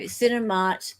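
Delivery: ordinary talk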